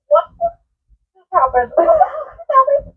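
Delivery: high, choked and slow, near tears; the words break as you cry; sobbing disbelief. Retold or imitated. Girl sobbing in her mom's car after the attack, choked up. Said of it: "because I'm thinking".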